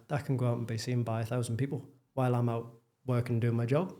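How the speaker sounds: usual speaking voice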